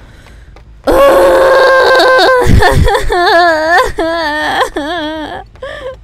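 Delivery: high, loud wail breaking into laughs; long cry into laughter. Real, not acted. Player overwhelmed by the game, wailing laughter.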